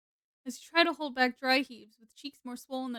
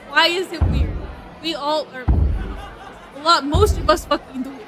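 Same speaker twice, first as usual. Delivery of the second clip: louder, heated rhetorical questions; loud indignant rant; 2 semitones higher, 10 dB louder than usual. Real, not acted. Streamer ranting at chat about sex, loud and indignant. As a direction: push back hard, voice raised in protest.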